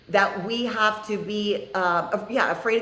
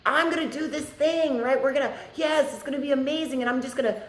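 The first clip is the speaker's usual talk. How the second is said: high, bright-eyed imitation of her own old eagerness; mock eager self; 6 semitones higher than usual. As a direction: play your past self all fired up, higher. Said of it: "I didn't come to it being like".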